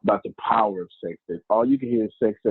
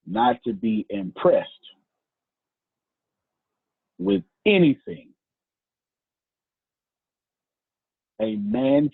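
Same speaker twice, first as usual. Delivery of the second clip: phrase by phrase with very long pauses; deliberate, spaced out for note-takers; slow delivery. Real, not acted. Preacher dictating a point to the congregation, slowly. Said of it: "I'll say it slowly".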